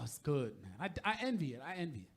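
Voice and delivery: normal talking voice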